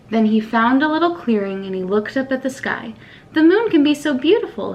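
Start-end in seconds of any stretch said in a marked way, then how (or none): none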